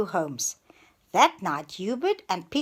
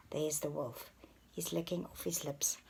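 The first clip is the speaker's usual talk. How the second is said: ordinary delivery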